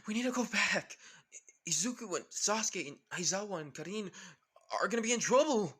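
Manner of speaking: loud, high-pitched alarm; acted panicky outcry; shocked. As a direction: cry out in shock, peaking at the end. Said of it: "With everyone shocked saying".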